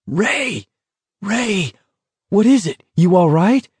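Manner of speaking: high, strained calling out; hollering over a crowd. A narrator's reading. McVrees calls to Ray over the roar of the crowd, hollering. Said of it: "somebody was hollering at him, screaming at him".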